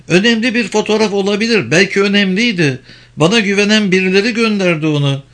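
high, fast woman's role; agitated Penelope. Acted. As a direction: pitch it up and rush the thoughts.

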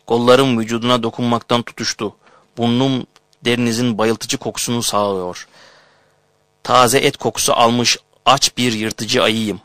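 usual speaking voice

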